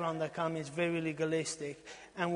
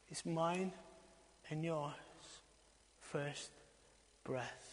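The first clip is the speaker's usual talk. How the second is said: solemn hush; 4 dB quieter, slower than usual. Acted. Preacher to congregation, hushed and solemn. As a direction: let each word fall quietly.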